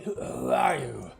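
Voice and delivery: wary goblin guard challenging a stranger; suspicious goblin. Acted. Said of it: "He's squinting, looking".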